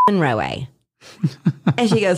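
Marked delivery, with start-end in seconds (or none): 0.1-0.7 "Monroe" with an extra final AY syllable, mon-ROH-ay; accent-mark pronunciation. Shown as a demonstration. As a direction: end "Monroe" on an added "ay" sound. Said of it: "it changes it to an A sound".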